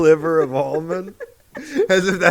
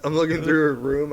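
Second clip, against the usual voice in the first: usual speaking voice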